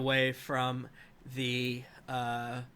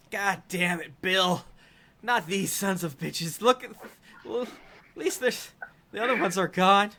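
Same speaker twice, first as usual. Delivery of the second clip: high, loud undead minion voice; loud, high-pitched warning to the boss; aggrieved ghoul; 7 semitones higher, 8 dB louder than usual. Acted. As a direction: complain at full volume, pitched well up. Second ghoul complains to Bill, Kroll.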